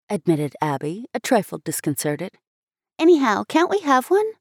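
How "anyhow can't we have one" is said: put-on higher little-girl voice, keen; a child pleading with her mother; girl asking for an altar; eager little girl. A narrator's reading. Abby voiced.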